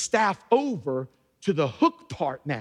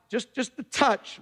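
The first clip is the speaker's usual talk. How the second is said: ordinary talk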